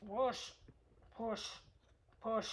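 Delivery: playful effort noise on "push"; short, separate puffs of "push". Shown as a demonstration. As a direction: say "push" like a pressing sound effect. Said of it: "Make that noise as you do it".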